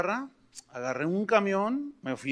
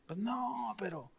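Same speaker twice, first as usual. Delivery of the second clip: soft, trailing-off answer; quiet, sheepish admission; sheepish reply; 3 semitones higher, 12 dB quieter, slower than usual. Retold or imitated. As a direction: mumble it, caught out.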